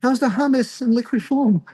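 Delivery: high, teasing date-night question; mock date voice. Asking his date Andrea about liquid hummus, a high mock-polite voice. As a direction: play it up as a sarcastic date. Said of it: "I'll call her Andrea".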